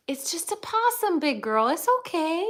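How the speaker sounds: high, sing-song reassurance to the dog; pet talk. Real, not acted. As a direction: coo at the big dog to calm her. Soothing barking dog.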